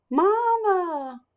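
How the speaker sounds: high, drawn-out call; crying for mama. Acted. Pip calling to his returning mother, high and drawn out.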